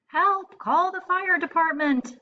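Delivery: high, loud panicked call for help; frantic cry. Acted. Mother sees a crocodile in her house, frantic shout. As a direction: shriek it like a scared mom. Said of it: "said the frantic mother".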